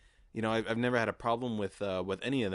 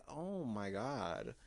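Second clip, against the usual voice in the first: slow, soft, drawn-out flirty "Oh my God"; sultry drawn-out gasp; 5 dB quieter, much slower than usual. Retold or imitated. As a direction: swoon over him like a flirty host. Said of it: "he, she was like".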